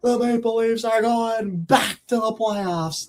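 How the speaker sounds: high, slow excited announcer call; Bowen. Retold or imitated. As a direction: belt it like a thrilled play-by-play man. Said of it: "He's like".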